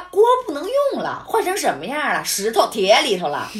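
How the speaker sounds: indignant snap back at the landlady; retold indignant retort. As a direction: fire back at her in disbelief. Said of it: "我说".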